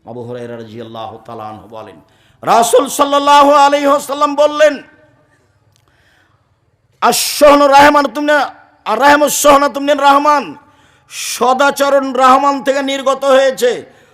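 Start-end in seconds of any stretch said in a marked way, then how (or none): 2.4-14.0 high, loud hadith proclamation; full-force preaching. Acted. (পূর্ণ শক্তিতে উচ্চস্বরে ওয়াজ)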